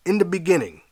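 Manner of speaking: sudden high cry. Acted. Congregation crying out to the preacher, high. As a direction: call it out high and strong. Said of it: "they cried".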